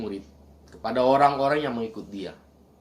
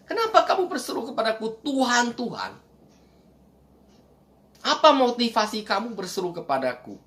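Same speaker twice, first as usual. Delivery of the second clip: much higher, challenging preacher's cry; fervent sermon question, much higher; preaching at full force; 9 semitones higher than usual. Acted. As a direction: demand "Kenapa" like a pulpit challenge. Preacher voicing Jesus's challenge.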